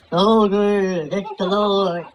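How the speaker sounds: drawn-out mock divine announcement; hammed-up voice of the Lord; booming God. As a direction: stretch the words out like God calling from a bush. God announces himself from bush.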